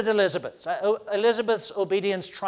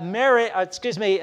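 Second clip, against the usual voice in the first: normal talking voice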